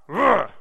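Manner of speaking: big rolled growl; loud growl. Shown as a demonstration. Preacher showing how the verse growls, loud growl. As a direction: growl like the word itself. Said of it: "it growls when you read it".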